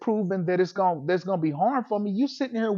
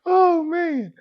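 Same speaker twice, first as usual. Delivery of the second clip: high, loud laughing groan; laughing sigh; 11 semitones higher, 4 dB louder than usual. Real, not acted. Laughing recovery after teasing.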